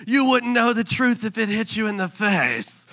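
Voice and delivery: hammed-up strained taunt; mock angry insult. Arguer hurling a common insult, mock angry.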